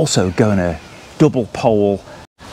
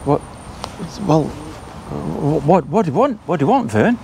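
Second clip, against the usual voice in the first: startled; 8 semitones higher than usual. Acted. Startled by a talking tent.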